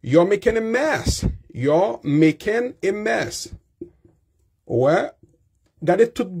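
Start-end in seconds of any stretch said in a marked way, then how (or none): 0.0-1.2 higher scolding You're making a mess; scolding child. Shown as a demonstration. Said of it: "ou pafwa kapab di". 1.5-3.5 slow, higher You're making a mess; slow scolding. Shown as a demonstration. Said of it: "ou pafwa kapab di".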